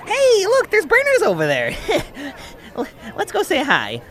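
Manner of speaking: nervous deflection. Acted.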